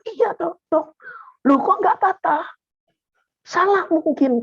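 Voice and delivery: lightly voiced high nurse; a hint of a puzzled nurse; nurse's reply. (suster menjawab dengan suara tinggi ringan)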